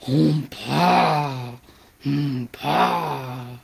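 a hushed, low elephant; elephant call. Acted. Reader voicing the elephant's muted trumpet.

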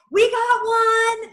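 high, loud, drawn-out cheer; jubilant guide. Acted. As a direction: holler it slowly like announcing a catch. Visualization of, like, your guy.

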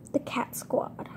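hushed whisper of the name; whispered reveal. Shown as a demonstration. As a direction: breathe the name secretly.

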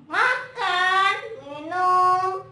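loud, high puppet guesses; Momon. Pretend. Momon guesses how to break fast, loud puppet voice. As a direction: call out each word like an eager puppet.

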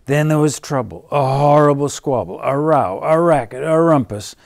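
fast, loud and rising; building excitement. A narrator's reading. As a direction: rush through the uproar. The quarrel erupts.

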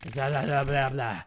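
put-on accented villain incantation, building; hammed-up ritual invocation; villainous Geppetto, invoking.

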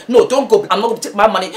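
usual speaking voice

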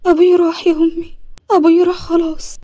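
crying out the news to mother; acted grieving daughter; tearful grief. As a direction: wail it, voice lifted and breaking into tears. Tells mother father died, crying.

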